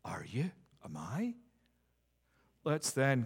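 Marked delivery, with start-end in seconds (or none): 0.1-1.3 soft whispered self-question; hushed, probing the listeners' hearts; searching whisper. Real, not acted. Preacher to his congregation, soft searching whisper.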